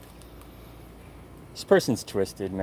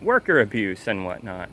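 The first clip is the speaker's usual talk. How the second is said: usual speaking voice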